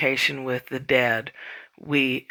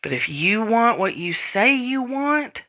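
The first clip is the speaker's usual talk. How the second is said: ordinary delivery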